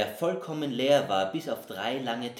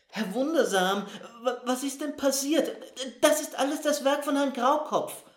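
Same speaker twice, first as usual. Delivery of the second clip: strained, high and louder, forced out; pressed out; 13 semitones higher, 4 dB louder than usual. Acted. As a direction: squeeze the words out in agitation. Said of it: "presste er hervor".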